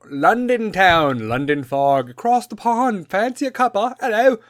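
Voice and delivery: high-pitched cartoon British accent; mock British accent. Riffing on going to London, mock British accent. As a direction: ham up a silly English accent.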